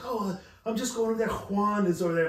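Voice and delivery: imitating her heavy Spanish pronunciation of Juan; mock Spanish accent. He mocks a friend's wife saying Juan, exaggerated Spanish accent. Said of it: "you don't have to say it like that".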